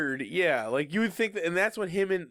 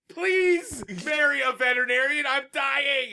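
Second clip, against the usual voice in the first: higher, laughing plea as the dog Bud; a dog begging his owner to marry a vet, higher and laughing; mock pleading dog; 7 semitones higher than usual. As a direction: ham up a whiny dog begging through laughter.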